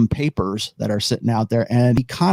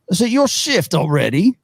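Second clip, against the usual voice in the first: hooded giant's question, pitched up; raised, put-on figure voice; deep-voiced figure; 6 semitones higher than usual. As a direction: ask it in a big character voice. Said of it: "very deep voice".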